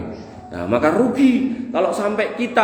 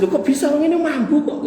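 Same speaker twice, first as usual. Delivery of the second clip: higher, half-laughing protest; protesting disbelief; 9 semitones higher than usual. Retold or imitated. Student protests to Hasan Al-Basri, high mock disbelief. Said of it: "dia pun berprotes".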